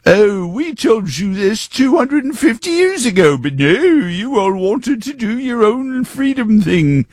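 put-on high, hammy British accent; bad British accent. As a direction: put on a silly upper-crust British accent. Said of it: "my bad British accent".

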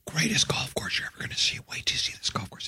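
whispered, secretive hype; hushed hype. Retold or imitated. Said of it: "Somebody say".

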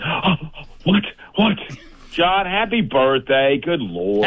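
gasped short "what"s, then a drawn-out exclamation; John Bird impression. Friend mimics John's astonished birthday greeting, wide-eyed impression. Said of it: "imagining John Bird with his eyes all wide and his mouth half open going".

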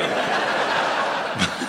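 soft unvoiced chuckle; breathy laugh. Real, not acted. Laughs at own joke.

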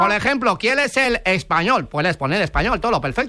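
Spanish in a put-on Chinese accent, Ls for Rs; mock Chinese accent. Fake clerk in China confirming the purchase to a customer, mock Chinese accent. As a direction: play a caricature Chinese clerk.

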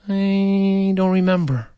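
slow, stretched-out first word; acted kid stalling under questioning; sheepish dodge. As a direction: drag it out evasively.

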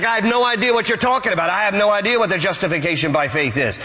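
high, rapid, clueless protest; mock bafflement. Thief quizzed on doctrine by angel, comically baffled. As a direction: rattle it off as a flustered newcomer.

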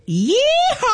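high, drawn-out yeehaw holler; cowboy yeehaw. Acted. Host announcing the Jackson Yeehaw, high cowboy holler. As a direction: let out a rodeo whoop. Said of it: "You got that out".

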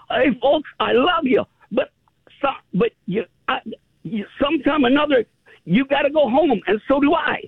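high, tender British impression; loving farewell. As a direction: say goodbye with affection. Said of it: "he said it with such a lovingness".

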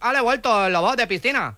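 put-on foreign accent with L for R; foreign-accented broken Spanish; mock foreign accent. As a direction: play a foreigner mangling Spanish, R turned to L. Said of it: "si no sabe hablar castellano".

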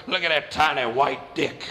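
low mock inmate voice; lightly voiced low-pitched bully; taunting inmate. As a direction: drop the pitch and jeer like a convict. Inmate taunts him in the shower.

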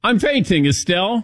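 high-pitched mock-indignant retort; retold exasperation. His retort to nurse while fainting.

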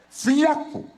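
word burst out high through a laugh; laughing disbelief. Real, not acted. Preacher laughing as the thin envelope comes out, high laughing burst. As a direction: laugh it out, way up in pitch.

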